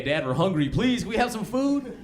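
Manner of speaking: put-on children begging dad for food; whining kids. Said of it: "It's like every other day".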